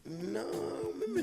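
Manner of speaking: high-pitched comic denial; put-on high voice, playing clueless; feigned non-recognition. Host replaying his answer to old classmates, high mock-clueless voice. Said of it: "I'm like".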